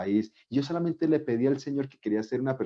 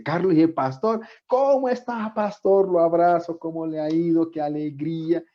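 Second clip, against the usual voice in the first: agudo y fuerte, lleno de alegría; saludo emocionado; 8 semitones higher, 5 dB louder than usual. Retold or imitated. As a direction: saluda al pastor querido con entusiasmo. Reencuentro con su pastor de niñez. (saludo alegre al pastor de su niñez)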